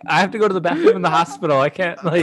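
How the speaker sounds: hurried retelling. Retold or imitated. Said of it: "I was like".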